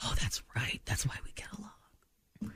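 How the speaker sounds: soft unvoiced whisper. Real, not acted. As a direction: whisper it confidingly. Sudden whisper about star signs.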